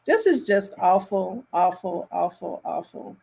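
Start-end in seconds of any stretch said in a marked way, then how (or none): none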